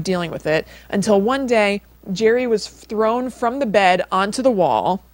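normal talking voice

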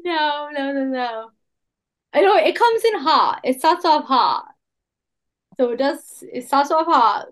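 ordinary talk

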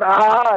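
high, loud pained cry; scream. Retold or imitated. Paul still stuck, high loud pained cry. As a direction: cry out in pain. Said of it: "Screaming so loud".

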